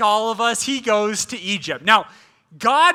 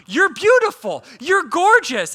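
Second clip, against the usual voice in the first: eager compliment; 7 semitones higher, 4 dB louder than usual. Shown as a demonstration. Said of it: "you can even do it now".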